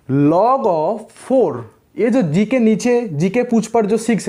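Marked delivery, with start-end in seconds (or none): 0.1-1.6 extremely slow, drawn out; slow demo reading. Shown as a demonstration. Teacher reading the log to students, very drawn out. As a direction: stretch "log of four" out long. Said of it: "इसको हम पढ़ेंगे".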